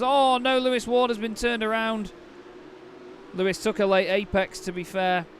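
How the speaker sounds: sudden jump in pitch and volume, alarmed; excited. Real, not acted. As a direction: burst out as the car spins. Crash seen live, commentator reacts.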